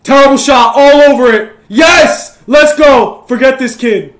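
loud, high victorious shouts; triumphant yelling. Real, not acted. Gamer cheering opponent's terrible shot, triumphant yelling. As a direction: yell it in real jubilation.

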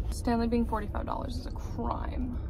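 hushed whisper of a gripe; a scandalised little whisper; whispered aside. Real, not acted. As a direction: whisper it so no one in the aisle hears. Whispering a complaint in the store.

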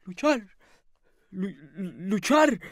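soft, halting echo, slowing with a stammer; bewildered lodger. A narrator's reading. Lodger echoing the landlady's word, bewildered. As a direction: repeat it as if not understanding. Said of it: "repitió deteniéndose ... como si no comprendiese".

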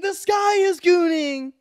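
high, slow dramatic shout. Acted. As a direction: belt it out like a movie climax. Dramatic movie line reenacted.

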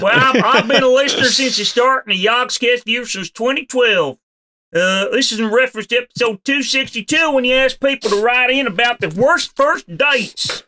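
put-on hillbilly accent. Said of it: "Please do your best hillbilly accent for this".

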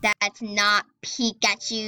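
slow, stretched words for emphasis; slow, drawn-out repeat. Real, not acted. Player rejecting a friend's fake Pikachu, slow and drawn out. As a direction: draw out "not Pikachu" very slowly.

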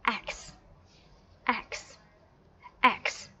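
AXE with the A sound joined to a final KS; blended letter sounds. Shown as a demonstration. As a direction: model AXE word by word, ending on KS. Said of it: "X sound X thành".

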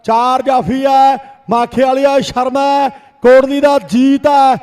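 rapid village kabaddi play-by-play; frantic rural sports commentary. Real, not acted. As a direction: call every raid live to the crowd. Second commentator calls raids. (ਤੇਜ਼ ਪੇਂਡੂ ਖੇਡ ਕੁਮੈਂਟਰੀ)

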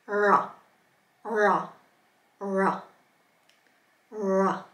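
"ra" with the tongue extended back; repeated "ra", retracted and untrilled. Shown as a demonstration. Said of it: "So extend that tongue back".

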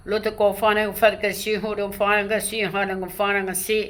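unbroken chain of repeating syllables; speaking in tongues. Real, not acted. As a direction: let strange spirit-language syllables run on. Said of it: "ภาษาที่พระเจ้าชักปากไม่เป็นให้พูด เป็นภาษาแปลกๆ".

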